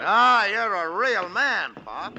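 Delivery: mock him with a slow jeer; sneering mockery. Gangster sneers at the crippled old man, mocking.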